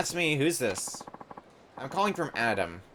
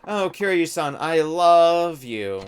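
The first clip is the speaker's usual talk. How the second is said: put-on loud, slow, slurred drunk voice; 5 semitones higher, 5 dB louder, slower than usual. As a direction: drag the words out like she's drunk. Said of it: "You're very drunk".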